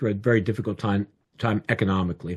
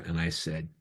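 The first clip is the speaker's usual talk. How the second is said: ordinary talk, nothing marked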